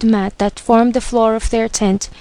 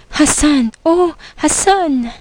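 raised pitch, drawn-out final name; storyteller's higher, heartfelt exclamation; emotional cry; 5 semitones higher than usual. A narrator's reading. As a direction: cry his name, stretching it out. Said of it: "she cried".

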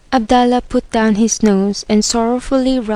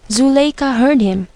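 plain reading voice

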